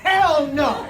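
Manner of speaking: flat-out refuse, big and loud; emphatic refusal. Retold or imitated. Margaret refusing to host his duffel bag, loud. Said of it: "And she goes".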